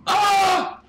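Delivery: high, loud scream; short, high yell of alarm. Real, not acted. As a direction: cry out suddenly at the top of your voice. Startled yell during a fall.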